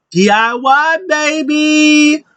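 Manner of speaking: excited hype. Real, not acted. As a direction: cheer it like a fan who can't contain himself. Hyping a favourite team reunion.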